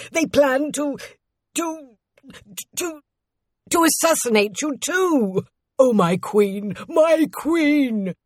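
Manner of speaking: stammering, climbing into high anguish; desperate servant crying out to his queen; panicked voice. A narrator's reading. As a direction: break the news in mounting panic. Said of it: "he said in a panicked voice".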